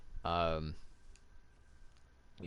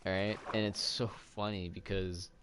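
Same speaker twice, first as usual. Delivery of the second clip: ordinary delivery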